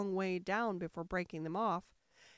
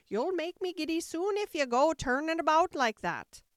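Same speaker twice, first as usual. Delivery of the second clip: high, loud fussy sheep voice; raised, complaining character voice; scolding Sheep; 7 semitones higher, 7 dB louder than usual. A narrator's reading. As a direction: nag loudly as the impatient Sheep.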